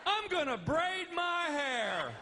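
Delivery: high, slow spaced-out voice; stoned dreamy. Retold or imitated. Stoned on the beach chair, high slow dreamy voice. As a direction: float it out completely stoned. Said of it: "just going".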